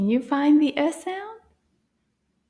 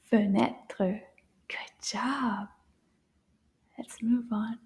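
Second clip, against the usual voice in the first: whisper; 3 semitones lower, 6 dB quieter than usual. Acted. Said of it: "teacher whispering to kids".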